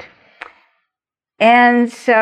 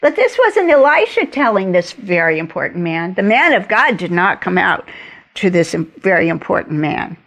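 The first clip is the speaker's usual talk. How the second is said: ordinary talk, nothing marked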